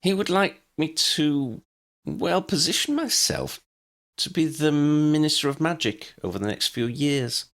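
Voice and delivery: unsure how to say it aloud; tentative, pausing confession; hesitant. A narrator's reading. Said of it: "continued in a hesitant tone".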